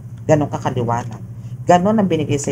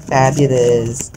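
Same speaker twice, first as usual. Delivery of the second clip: drawn-out sassy fabulous; 3 semitones lower, 3 dB louder, much slower than usual. Acted. Tarot reader praising the client.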